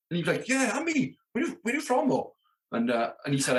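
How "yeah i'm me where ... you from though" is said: put-on Welsh accent. Stagehand voiced in story.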